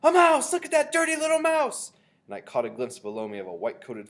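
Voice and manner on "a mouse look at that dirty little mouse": very high, loud shriek of discovery; alarmed yell. A narrator's reading. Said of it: "I heard a man's voice yelling".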